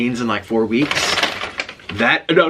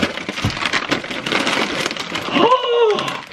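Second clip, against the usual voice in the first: sharp, high excited gasp. Real, not acted. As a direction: gasp in delight at the gift. Recognizes favorite Chinese snack.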